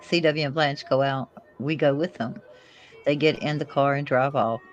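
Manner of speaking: ordinary delivery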